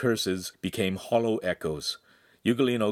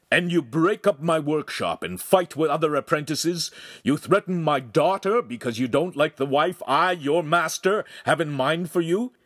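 furious master; 8 semitones higher, 4 dB louder than usual. A narrator's reading. Master berating his apprentice, loud and furious. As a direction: tear into the boy with scorn.

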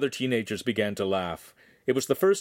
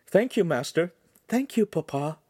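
put-on young woman voice; 8 semitones higher than usual. Girls thank master.

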